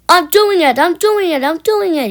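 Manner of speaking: high, loud effortful puffing; panting. A narrator's reading. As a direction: strain up the hill. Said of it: "he panted".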